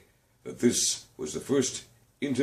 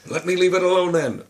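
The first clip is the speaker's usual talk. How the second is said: put-on loud, gruff old voice; gruff retort; 10 semitones higher, 8 dB louder, faster than usual. Scrooge shuts down his nephew, gruff and loud. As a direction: shove the words out angrily.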